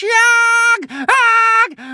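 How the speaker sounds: very high loud shrieks; furious howls. A narrator's reading. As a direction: howl with rage. Witch howling in fury.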